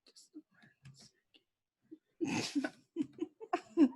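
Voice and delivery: quick, whispered fluster; hushed aside. Real, not acted. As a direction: whisper it under your breath, unsure how to begin. Flustered aside while setting up.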